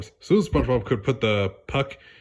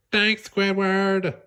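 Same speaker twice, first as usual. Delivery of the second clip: cheerful thanks; 9 semitones higher, much slower than usual. Acted. SpongeBob waving cheerfully.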